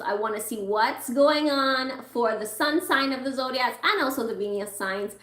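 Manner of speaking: usual speaking voice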